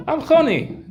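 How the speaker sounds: high, lightly voiced old sage; a high-pitched hint of old Choni; Choni announcing himself.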